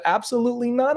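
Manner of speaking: firm refusal. Retold or imitated. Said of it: "I would have said".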